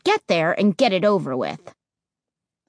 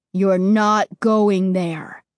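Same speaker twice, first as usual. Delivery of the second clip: menacing; 4 dB louder, much slower than usual. Acted. Ray repeating his warning to the boys, slow and menacing. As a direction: drag each word out like a threat. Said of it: "his words sounded menacing".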